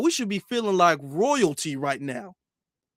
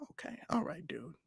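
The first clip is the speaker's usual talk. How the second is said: hushed unvoiced aside; whispered aside; 3 semitones lower, 18 dB quieter than usual. Real, not acted. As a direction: drop to a breathy whisper. Sudden whisper to self.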